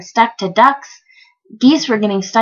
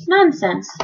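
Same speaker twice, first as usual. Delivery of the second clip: higher, mildly scornful; snorting dismissal; 4 semitones higher than usual. A narrator's reading. Captain dismissing Bartholomew's warning, mildly snorting. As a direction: brush it off curtly. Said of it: "snorted the captain".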